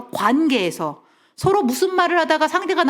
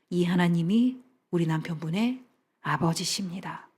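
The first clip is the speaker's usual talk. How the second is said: ordinary talk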